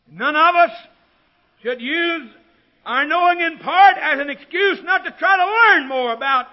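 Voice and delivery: loud, high preacher's proclamation; ringing pulpit declaration at full volume; full-force preaching. Real, not acted.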